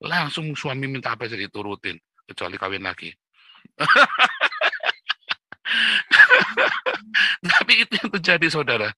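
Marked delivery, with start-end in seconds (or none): 3.8-7.5 high-pitched, loud, long cackle; big squealing belly laugh. Real, not acted. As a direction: burst out laughing at your own joke. Laughs after his own joke.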